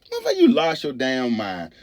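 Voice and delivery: normal talking voice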